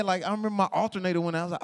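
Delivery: ordinary talk, nothing marked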